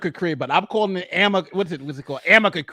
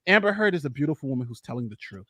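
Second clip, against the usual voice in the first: mock sincere; 5 semitones lower, 5 dB quieter than usual. Fake earnest defence of Amber for the stream. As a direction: fake earnest, lower and softer. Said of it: "I can't even say it without laughing".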